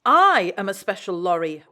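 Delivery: loud indignant huff; offended pride in every word; huffy. A narrator's reading. Lorry insisting she is special, loud huff. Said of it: "huffed Elizabeth".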